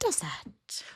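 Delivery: soft, whispered show intro; hushed title drop. Pretend. Host announcing the segment, hushed whisper.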